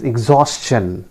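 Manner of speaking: exhaustion slowly as ig-ZAWS-chun; careful S-CH in exhaustion; correct pronunciation. Shown as a demonstration. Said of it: "repeat that". (exhaustion का सही उच्चारण, धीरे से)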